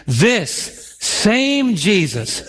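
said together, very slow. Shown as a demonstration. Preacher leading the church in repeating the verse, drawn out. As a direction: lead the congregation in saying it slowly. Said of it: "say that with me".

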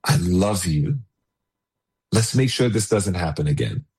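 put-on father comforting his child after discipline, tenderly; tender I love you. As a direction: say it gently, slowly, from low down. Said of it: "without saying".

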